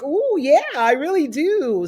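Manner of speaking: high and loud, gushing and slowed; excited ooh. Retold or imitated. Voicing Candace eager to a new friend, high and gushing. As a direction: gush like you really want it. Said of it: "she's like".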